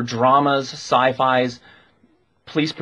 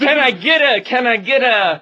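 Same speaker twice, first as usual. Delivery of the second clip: excited; 10 semitones higher, 4 dB louder than usual. Real, not acted. Leading up to a cheer.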